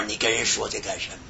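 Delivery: ordinary talk, nothing marked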